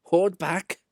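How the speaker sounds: plain reading voice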